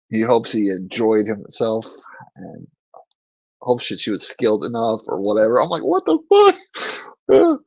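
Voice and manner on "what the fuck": very high, drawn-out mock disbelief; squeaky slow retold astonishment; incredulous. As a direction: stretch it out high, close to cracking up. Teller's incredulous reaction, breaking up.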